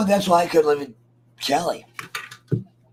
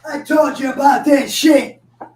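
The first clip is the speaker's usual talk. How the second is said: louder, higher scolding; scolding dog; 8 semitones higher, 6 dB louder than usual. Real, not acted. To his dog wanting out, firm loud scolding. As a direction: tell the dog off firmly.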